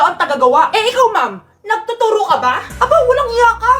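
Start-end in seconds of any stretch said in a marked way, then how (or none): 2.7-3.7 higher, louder offended scold; teacher offended by a student, mock indignant. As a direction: bristle at the cheeky student.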